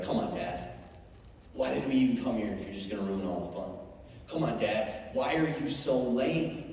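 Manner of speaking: put-on soft, let-down child complaining; quietly guilt-trip Dad; bummed-out kid. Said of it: "she was getting kinda bummed out".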